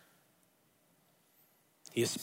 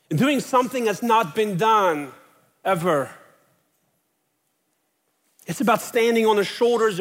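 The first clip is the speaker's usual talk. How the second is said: higher-pitched, driving sermon delivery building in force; impassioned preaching; 6 semitones higher, 4 dB louder than usual. Acted. Preacher telling a congregation of those who followed Jesus, impassioned. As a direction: preach it with swelling conviction.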